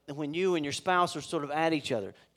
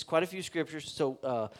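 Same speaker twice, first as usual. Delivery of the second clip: ordinary talk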